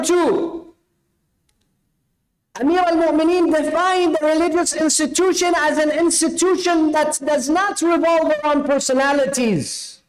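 high, ringing sermon voice, each point driven home; preaching at full force. Acted. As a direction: preach it to the crowd, rising on every listen.

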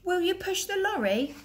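high pleading call. Acted. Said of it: "called Fred".

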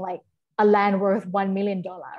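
ordinary talk, nothing marked

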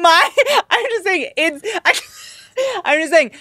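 laughing. Real, not acted. Defending her remark, laughing.

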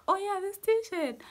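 hammed-up delighted discovery; mock surprise. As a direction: act out spotting the t-shirt all over again. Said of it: "but then I was like".

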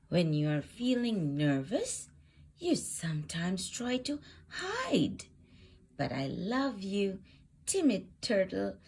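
plain reading voice

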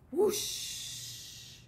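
breathy rising whoosh; whoosh blowing sound. Acted. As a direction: blow it like a gust of wind. Wolf blows house down.

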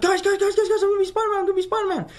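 bursting to tell the family; retold giddy yelling; ecstatic announcement. Announcing big news to family.

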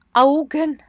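usual speaking voice

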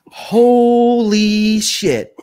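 high, loud, hugely stretched exclamation; drawn-out amazement. Retold or imitated. Lieutenant spots the find in the grass, drawn-out amazement. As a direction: stretch it out in mock awe.

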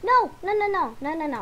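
loud, high string of no's; emphatic no. Real, not acted. Sorting clothes, shouting no at an outfit. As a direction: reject it loudly and fast. Refusing a dress in the game.

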